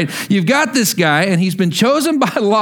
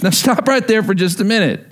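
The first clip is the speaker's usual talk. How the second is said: ordinary talk, nothing marked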